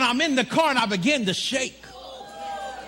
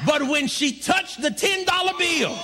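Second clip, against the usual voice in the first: full-force preaching; 6 semitones higher than usual. Acted. Preacher telling the church the drive-thru miracle, full-force preaching. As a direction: build it to a shouting climax.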